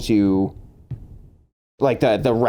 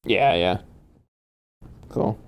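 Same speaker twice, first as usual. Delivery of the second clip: ordinary talk, nothing marked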